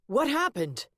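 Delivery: high shouted question; yelling. A narrator's reading. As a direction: yell it while running. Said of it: "he yelled as he ran".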